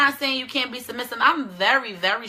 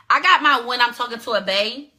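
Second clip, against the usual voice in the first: normal talking voice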